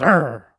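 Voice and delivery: mock dog snarl, a mild growl; imitated growling dog.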